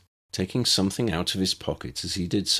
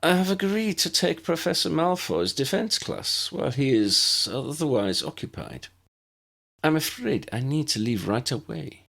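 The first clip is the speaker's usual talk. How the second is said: raised, lightly voiced teacher; professor's voice; 9 semitones higher than usual. A narrator's reading. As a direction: play the fussy professor, apologetic. Narrator voices professor character.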